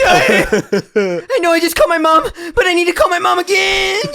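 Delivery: high, loud, through laughter; barely getting words out; laughing hysterically. Real, not acted. Laughing hysterically mid-speech.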